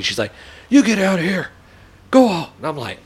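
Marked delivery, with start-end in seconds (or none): 0.7-2.5 high, loud mock shout to leave; retold yell. Neighbor yelling at a dad on the sidewalk to leave. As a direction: chase him off loudly. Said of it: "she's yelling at me".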